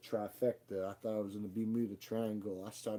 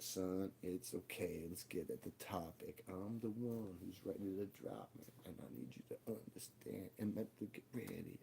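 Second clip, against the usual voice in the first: quiet calm reassurance; 2 semitones lower, 10 dB quieter than usual. Retold or imitated. Father calmly comforts his son.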